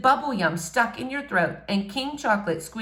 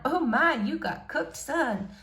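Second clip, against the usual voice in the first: put-on higher, teasing older woman; lightly voiced lunch lady; 6 semitones higher, 4 dB quieter than usual. A narrator's reading. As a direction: tease him like the cafeteria lady. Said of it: "cafeteria lady joins in".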